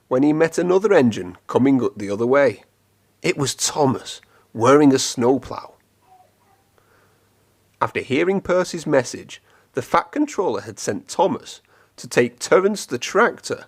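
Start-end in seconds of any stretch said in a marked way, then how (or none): none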